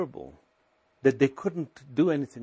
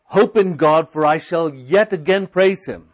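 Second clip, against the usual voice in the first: loud declaration of scripture; preaching; 2 semitones higher, 8 dB louder than usual. Shown as a demonstration. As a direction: proclaim it with faith.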